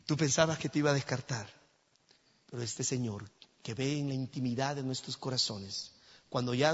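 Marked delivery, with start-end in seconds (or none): none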